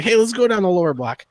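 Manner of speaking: a hint of an excited barfly; eager drinking buddy. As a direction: coax a pal along, pitch lifted. Voicing Ricky urging him on.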